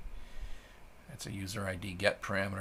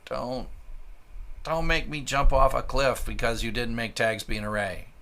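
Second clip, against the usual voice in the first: loud exasperated rant; 4 semitones higher, 8 dB louder than usual. Real, not acted. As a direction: rant at the missing array. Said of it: "Sorry, ranting".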